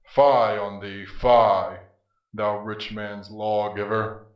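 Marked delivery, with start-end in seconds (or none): 0.0-1.8 loud, contemptuous fie; scornful outburst. Acted. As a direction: spit the scorn at him. Death scorns Apollo.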